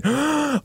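sharp high mock gasp; dramatic gasp. Reader gasps at the dragon's arrival.

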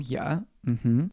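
normal talking voice

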